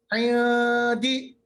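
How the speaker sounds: عین دی with the n half hidden, not nasal; ikhfa hidden nun. Shown as a demonstration. Teacher models ikhfa on عین دی. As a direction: say عین دی with the n kept in the mouth. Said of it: "آواز بالکل ناک میں نہیں لے جانا".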